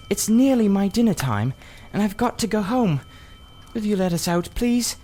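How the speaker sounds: put-on high girlish voice, asking politely; a young girl pleading to go home; Polly, a polite young girl. A narrator's reading. Polly asking Uncle Andrew to let them out, girlish and polite.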